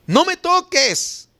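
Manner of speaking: high, slow "No me toques"; drawn-out refusal. Acted. Jesús to María as she goes to embrace him, drawn-out refusal. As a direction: stretch "No me toques" out, pitched well up.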